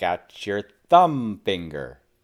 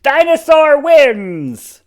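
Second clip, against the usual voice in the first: slow, loud triumphant cheer; 8 dB louder, slower than usual. A narrator's reading. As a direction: announce the victory proudly. Triumphant announcement.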